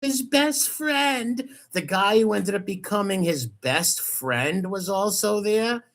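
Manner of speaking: high, drawn-out mocking disbelief; incredulous. Real, not acted. Host throwing the best friend claim back, incredulous. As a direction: echo it slowly, unable to believe it.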